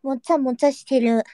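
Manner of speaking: ordinary talk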